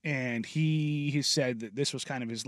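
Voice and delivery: ordinary talk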